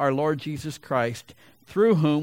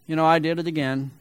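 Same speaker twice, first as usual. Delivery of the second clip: ordinary talk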